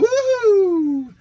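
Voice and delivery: long, high, loud whoop; excited woohoo. Real, not acted. Host rounding off Tweet of the Week, high excited whoop. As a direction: cheer a drawn-out "woo-hoo".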